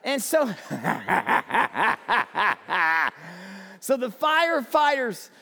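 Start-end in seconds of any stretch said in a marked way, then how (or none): 0.5-3.6 cracking up at his own joke; laughing aloud. Real, not acted. Preacher to congregation, breaking into laughter.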